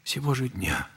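a soft whispered blessing. Acted. Elder sending the grieving mother home to her husband, whispering.

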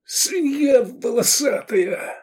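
strained high, drawn-out insult; puffing with effort. A narrator's reading. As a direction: squeeze out the insult while fighting. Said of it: "пыхтел Клодий". (пыхтя, натужно и протяжно)

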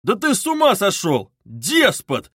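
loud, outraged outburst; indignant roar. A narrator's reading. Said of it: "заревел Разумихин".

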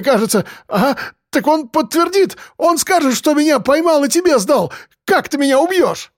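very high-pitched, desperate babble; wailing, breathless-sounding panic with hesitations; panicked pleading. A narrator's reading. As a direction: argue frantically for your life. Addict pleads for his life.

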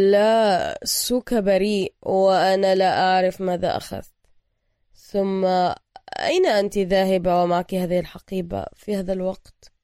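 loud, drugged monotone with stretched words; struggling to articulate. A narrator's reading. Drugged hero trying to control his mouth, slurred and labored. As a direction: drag the words out, mouth not obeying. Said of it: "يحاول السيطرة على فمه".

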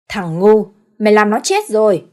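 loud furious reprimand; angry scolding. Acted. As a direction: chew out the fool. Father scolds son.

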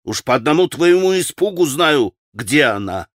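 usual speaking voice

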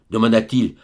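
ordinary delivery, nothing marked

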